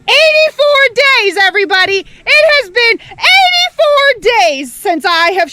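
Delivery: excited announcement. Real, not acted. Announcing days without shower.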